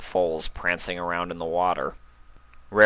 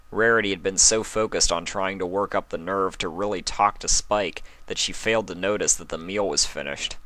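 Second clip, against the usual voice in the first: ordinary delivery, nothing marked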